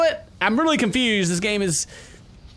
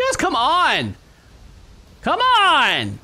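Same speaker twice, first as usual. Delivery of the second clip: higher, louder impatient plea; exasperated yelling; 9 semitones higher, 4 dB louder, much slower than usual. Real, not acted. Player hurrying the guys along, exasperated. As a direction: yell it, stretching the last word. Player urges stuck characters.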